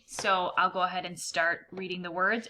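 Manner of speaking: ordinary talk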